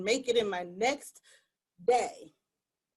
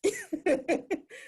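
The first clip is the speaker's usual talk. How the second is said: short real laugh, pitched up; 9 semitones higher than usual. Real, not acted. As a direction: burst into a light laugh. Laugh after joke.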